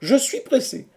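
high, loud protest of a man held back; exclaiming. A narrator's reading. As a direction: cry it out high and urgent. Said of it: "s'écria Quatercam". (protestation criée, très aiguë)

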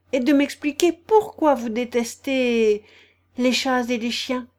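ordinary delivery, nothing marked

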